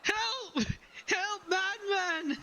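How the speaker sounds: very high, wailing cry for help; acted high-pitched panic; panicked bard. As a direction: call for rescue from a madman. Grabbed bard calls for help.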